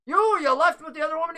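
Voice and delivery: a touch accusing, a bit hurried; NPC voice. Acted. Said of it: "He goes".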